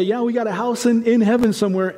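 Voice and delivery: high-pitched, breezy mock agreement; imitating a chorus of believers; congregation chiming in. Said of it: "and we all say".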